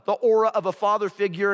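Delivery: usual speaking voice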